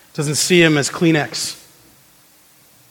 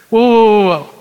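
louder and higher, a playful whoa; mock alarm; 4 semitones higher, 4 dB louder than usual. Real, not acted. Preacher halting at shocking words, mock alarmed. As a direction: pull the room up short in mock shock. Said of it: "shocked reaction to language".

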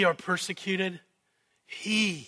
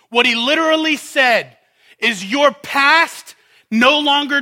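louder, higher preaching voice voicing Jesus; fervent pulpit preaching; full-force preaching; 5 semitones higher, 9 dB louder than usual. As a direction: preach it hard, building to the climax.

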